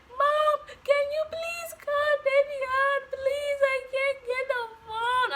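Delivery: loud, high-pitched wail, acted out; crying plea. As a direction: wail to mom slowly through mock tears. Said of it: "I'm crying my eyes out".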